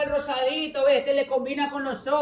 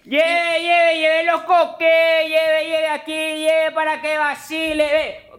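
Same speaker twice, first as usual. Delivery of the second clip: hyped fairground barker; 4 semitones higher, 6 dB louder than usual. Acted. Street vendor hawking clothes.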